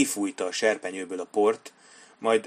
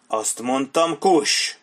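loud, high snapped order; angry snap; 5 semitones higher, 4 dB louder, much slower than usual. Acted. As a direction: bite off the last word like a whip. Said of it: "csattant föl Sharp". (dühösen, hangosan csattanó parancs)

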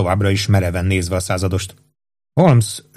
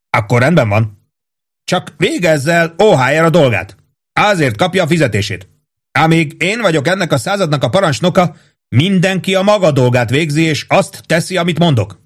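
stern captain; 8 semitones higher than usual. Acted. Captain to sergeant, asserting his rank firmly. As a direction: lay down the law as commander.

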